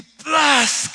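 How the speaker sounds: loud, high "plas" smack; imitated splash. Storyteller as the whale falls into the sea, big splash noise. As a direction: burst out a splashing sound effect.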